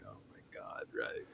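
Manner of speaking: barely audible whispered groan of annoyance; exasperated whisper. Retold or imitated. Annoyed student as the man sits right beside him, exasperated whisper. Muttered reaction to annoying seat neighbor.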